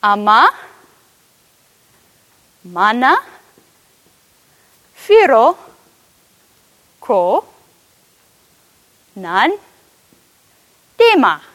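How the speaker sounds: each Oromifa word alone with long pauses; slow word by word Oromifa. Shown as a demonstration. Preacher shows the Oromifa sentence to the room, slow word by word. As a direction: teach "Ama, mana, firo, ko, nan, dema." slowly, word by word. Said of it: "you would say".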